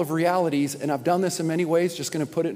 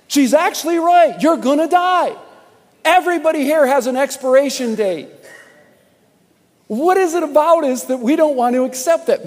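high, loud emphatic sermon voice; full-force preaching; 8 semitones higher, 7 dB louder than usual. Acted. Pastor insists to the congregation we will all die, loud and high. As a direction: shout the truth at them.

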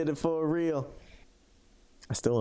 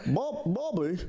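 Hank Hill voice; 5 semitones higher than usual. Pretend. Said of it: "I can do three voices".

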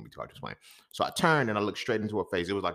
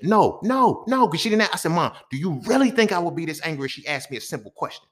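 loud, high angry outburst; heated rant; 9 semitones higher, 6 dB louder than usual. Retold or imitated. Explaining his rage to his mom, loud and heated. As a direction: rant like it's happening again.

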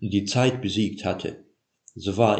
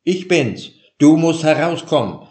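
urgent call; 8 semitones higher, 8 dB louder than usual. A narrator's reading. Jakob urging Laban at dawn.

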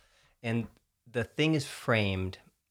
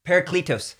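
higher, louder word in a Texan accent; 9 semitones higher, 9 dB louder than usual. Real, not acted. Speaker retrying a Greek word for a teasing co-host, Texan accent. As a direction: let the Texan twang come back on the Greek word. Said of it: "that just brought my Texan back".

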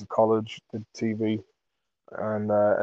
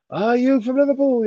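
much higher, put-on voice; mock Scouse question; 13 semitones higher, 4 dB louder than usual. Friend asking the cheeky lad where he's from. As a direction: pipe up like a curious lad. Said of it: "quoting Enda asking lad".

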